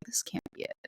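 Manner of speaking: breathy inner-voice whisper; whispered thought. Retold or imitated. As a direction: hush it like a private doubt.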